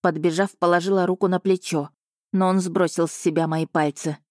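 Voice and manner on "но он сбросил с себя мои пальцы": plain reading voice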